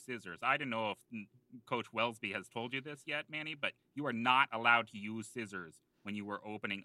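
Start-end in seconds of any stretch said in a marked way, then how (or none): none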